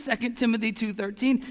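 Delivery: normal talking voice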